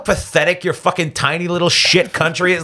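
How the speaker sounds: high-pitched retold tirade; lighting him up. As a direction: tear into the heckler in a replayed rant. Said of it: "I just lit him up".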